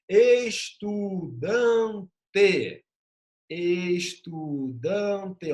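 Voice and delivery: "Estudante" said very slowly, each syllable drawn out. Shown as a demonstration. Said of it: "Cada vogalzinha".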